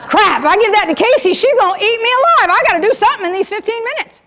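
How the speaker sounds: high, hurried panicked voice; mock panic. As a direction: play a worker scrambling before the boss sees. Acts out agent panicking over tracking sheet.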